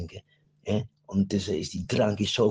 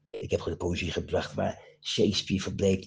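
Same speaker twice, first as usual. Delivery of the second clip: normal talking voice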